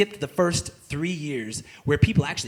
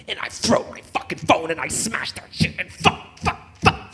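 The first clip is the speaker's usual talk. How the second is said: whispered rage. Acted. Smashing phone again.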